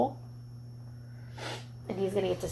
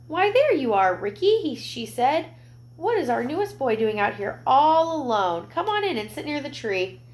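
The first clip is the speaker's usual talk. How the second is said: higher, friendly welcoming lady; kind lady; 6 semitones higher, 3 dB louder than usual. Acted. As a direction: greet the lost boy kindly. Lady voiced in story.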